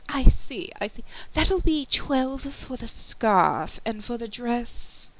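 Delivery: a genteel merchant quoting prices; fussy shopkeeper. Acted. Boutique owner to a customer, fussy shopkeeper voice.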